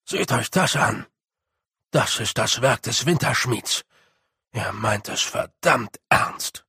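a gruff little warrior, grim and serious; gruff Rob. Acted. Rob warns the others about the Wintersmith, gruff and grim. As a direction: mutter darkly about the Winter smith. Rob's voice in the story.

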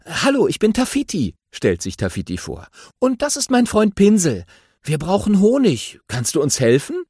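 Tafiti voice. A narrator's reading. Tafiti politely asks the grumpy badger for help, eager and high.

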